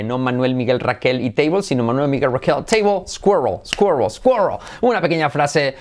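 normal talking voice

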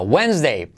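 "Wednesday" said "wens-day"; no first D at all in "Wednesday"; silent D. Shown as a demonstration. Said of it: "¿Dónde está la D? En ningún lado". ("Wednesday" con la D muda)

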